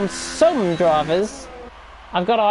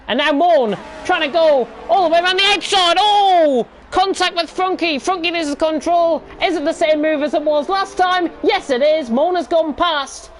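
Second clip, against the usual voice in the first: excited commentary; 5 semitones higher than usual. Real, not acted. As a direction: shout the crash as it happens. Commentator shouts at contact.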